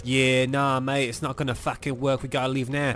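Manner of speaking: brash Australian brush-off; Australian accent. Acted. Shrek refusing the princess's romance, Australian accent. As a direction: put on a broad Australian accent. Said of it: "I'm doing an Australian accent".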